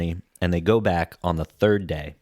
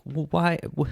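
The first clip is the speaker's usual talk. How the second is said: lightly voiced upset kid, higher pitch; a hint of a pleading little boy, pitched up; imitating Lil Skippy; 6 semitones higher than usual. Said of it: "Lil Skippy starts giving his little speech".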